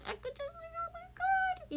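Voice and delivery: very high, soft cooing squeal. Real, not acted. Grandparent squealing over the baby photo, very high.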